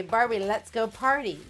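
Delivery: ordinary talk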